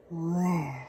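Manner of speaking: low dragon roar; dragon bellow. Acted. Dragon bellowing at Boy in battle, low roar. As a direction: roar like a huge beast. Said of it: "bellowed the dragon".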